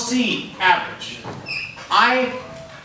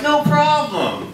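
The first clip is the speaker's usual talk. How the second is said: breezy no problem; 9 semitones higher, much slower than usual. Retold or imitated. Bluffing his way into school.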